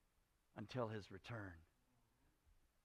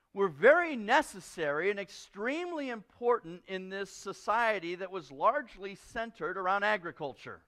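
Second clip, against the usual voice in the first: normal talking voice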